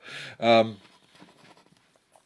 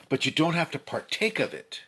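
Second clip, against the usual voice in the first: usual speaking voice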